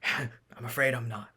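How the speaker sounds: soft, slow dying voice; weak, dying. Acted. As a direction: breathe out last words. Dying Kogar's last words.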